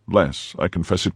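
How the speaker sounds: ordinary delivery, nothing marked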